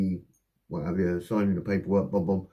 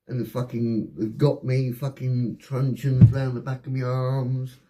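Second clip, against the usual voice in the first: ordinary talk, nothing marked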